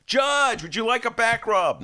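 mock Biden offering a back rub; Joe Biden impression. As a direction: fawn shamelessly. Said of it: "he'd be so".

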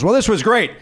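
building to a high, full-throated shout of the name Pardo; mock yell. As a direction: yell the name across the green room. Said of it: "At the top of his lungs".

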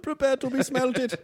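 put-on high, slow, menacing German accent; villain German accent. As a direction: threaten them like a German villain. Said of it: "that German accent is really good".

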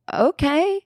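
high, questioning rise, imitating non-recognition; puzzled okay. Waitress not recognising a returning customer, puzzled high okay. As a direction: answer as if you don't know this person.

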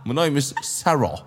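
slow, hammed-up British accent; mock textbook British English; english accent in german. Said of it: "englische Serien geguckt haben".

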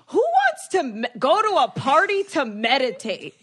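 high mock-outraged mother; hammed-up high-pitched objection; incredulous mom. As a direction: imitate a mom scoffing in disbelief.

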